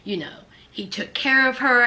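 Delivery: ordinary talk, nothing marked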